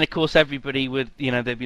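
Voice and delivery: ordinary delivery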